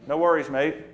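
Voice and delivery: a light Aussie "No worries, mate"; easygoing Aussie reply; Australian accent. Acted. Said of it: "That's how Australian sheep talk".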